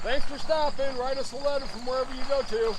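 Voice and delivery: high, playful teasing calls. Real, not acted. Grandpa teasing his two-year-old granddaughter leaving for a walk, high playful. I gotta pick on her.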